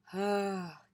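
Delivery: short breathy sigh of landing; demonstrated sigh. Shown as a demonstration. Teacher showing how landing can sound, relieved sigh. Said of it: "Landing can be a sigh".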